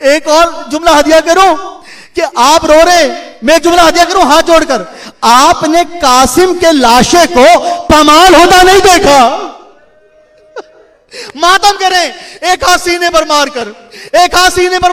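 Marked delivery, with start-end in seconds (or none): 0.1-9.5 rising mournful preacher's chant, drawn out; preacher moving mourners to weep; lamenting majlis recitation. Acted. Preacher reciting masaib to the mourners, in a rising mournful chant.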